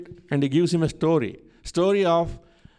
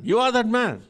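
higher, louder outburst of accusation; angry accusation; 8 semitones higher, 4 dB louder than usual. Retold or imitated. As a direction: fling the charge at the king. Prophet confronts King David.

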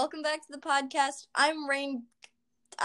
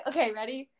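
ordinary talk

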